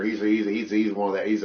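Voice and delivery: normal talking voice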